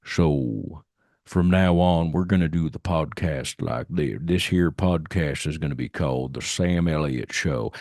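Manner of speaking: low, burly voice close to the microphone; Sam Elliott impression. Host renaming his podcast as a joke, burly Sam Elliott impression. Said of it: "Another fun one to do is Sam Elliott".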